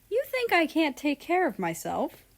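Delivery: high, hammed-up hurt pride; exaggerated fake indignation; mock offended. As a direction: overplay being offended. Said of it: "He over-exaggerated his tone to seem like he was offended".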